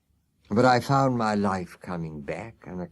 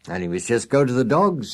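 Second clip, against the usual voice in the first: harsh Skinner voice; 4 semitones higher, 6 dB louder than usual. A narrator's reading. Skinner the hard master.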